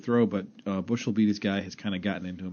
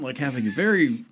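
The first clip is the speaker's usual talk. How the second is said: ordinary delivery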